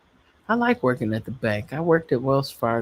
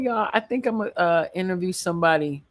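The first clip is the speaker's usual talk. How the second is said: ordinary talk, nothing marked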